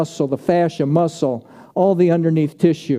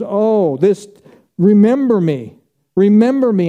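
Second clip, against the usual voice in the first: pleading thief; 5 semitones higher, 5 dB louder than usual. Acted. Thief voiced pleading to Jesus.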